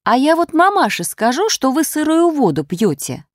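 a scandalized maid catching her out; shocked tattling maid; gasping maid. A narrator's reading. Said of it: "Вошла горничная и ахнула".

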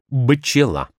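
«бчела» spoken as spelt, with a voiced b; «бчела» opening on b, not p; voiced b before ch. Shown as a demonstration. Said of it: "а писалось как".

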